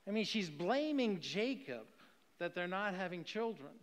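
ordinary talk, nothing marked

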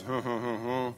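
muted, sceptical "huh huh" chuckle; restrained mock chuckle, unconvinced; imitated doubtful laugh. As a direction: mimic a laugh of disbelief. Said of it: "Initial laughter was".

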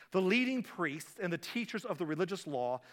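usual speaking voice

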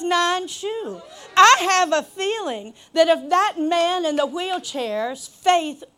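impassioned preacher, big stretched emphatic words; full-force preaching. Real, not acted. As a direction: drive it home to the congregation.

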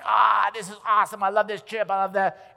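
mock-thrilled tourist; retold delight. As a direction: gush over the trip. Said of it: "I'm like".

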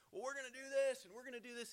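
high-pitched imitation of boasters; sing-song parody of big plans; mock boasting. Voices people boasting about tomorrow.

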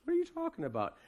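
high mock indignant Herod; scoffing Herod. Preacher voicing Herod scoffing at the Magi, high and mocking. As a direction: sneer it like a jealous king.

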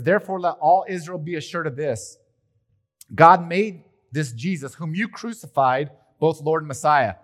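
loud, high proclaiming of scripture; preaching at full force. Acted. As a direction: boom the verse out to the congregation. Preacher reads Peter's sermon.

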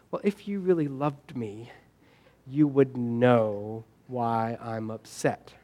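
soft, slow, sulky plea from a wounded partner; mock hurt complaint. Imitating a hurt spouse who expects mind-reading, soft and slow. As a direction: murmur it like a partner sulking after a fight.

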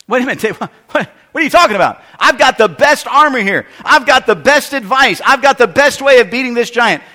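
a king who can't believe he's refused; insistent King Saul. Acted. Preacher voices King Saul insisting.

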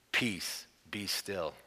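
soft, low, steady command; calm rebuke. Retold or imitated. Jesus rebuking the wind and sea, quiet and calm. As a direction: still the sea with a hushed word. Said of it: "he rebukes the wind and said to the sea".

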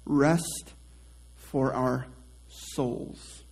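hushed and slow, savoring each word; gentle slowed whisper-soft repeat. Shown as a demonstration. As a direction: let the words linger softly. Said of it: "How good does that sound?".

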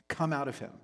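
ordinary talk, nothing marked

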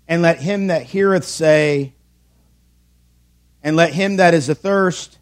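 scripture reading. Acted. Reads scripture verse slowly.

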